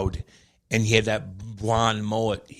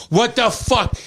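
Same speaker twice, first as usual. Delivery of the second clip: mock teasing Prong; 10 semitones higher, 4 dB louder, slower than usual. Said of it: "Prong starts torturing Andy".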